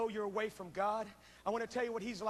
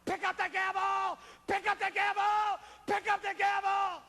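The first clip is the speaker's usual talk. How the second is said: Satan's shout, rising louder each time; Satan demanding; 10 semitones higher, 7 dB louder than usual. Acted. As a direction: bark it like Satan demanding judgment. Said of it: "Satan is going".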